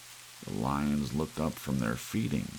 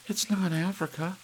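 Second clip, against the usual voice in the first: breathless. Acted. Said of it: "she said breathlessly".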